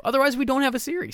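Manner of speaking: high, fast and loud punchline; excited. Real, not acted. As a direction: blurt it out excitedly. Joking meta punchline.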